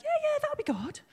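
high, amused imitation of husband; lightly voiced husband, high and breezy; husband's reply.

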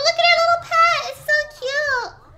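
high, loud cooing over a cute pet; squealing at the little pet, cooing. Real, not acted. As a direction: gush like at a puppy.

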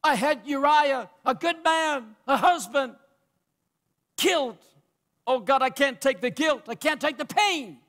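high, anguished preacher's plea; acted cry of guilt from the pulpit. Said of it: "Then he cried out".

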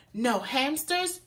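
ordinary delivery, nothing marked

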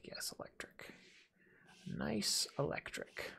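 softly whispered paint choice; whisper to self. Real, not acted. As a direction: whisper it like a private pleased aside. Choosing paint, muttering to himself.

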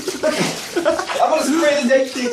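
laughing, breathless from the cold; gasping in ice cold water. Real, not acted. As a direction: gasp and laugh through the freezing shock. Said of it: "I can't breathe again".

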